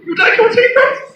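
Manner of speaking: put-on posh English accent. Hypnotist teasing an English volunteer, mock posh English accent.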